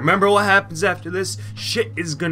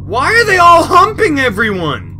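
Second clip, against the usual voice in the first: high, loud cry of disbelief; shocked outcry; 10 semitones higher, 9 dB louder than usual. Real, not acted. Gamer reacts to characters humping everyone, shocked outcry. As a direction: exclaim high and loud, appalled.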